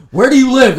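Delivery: angry shout. Retold or imitated. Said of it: "I was like".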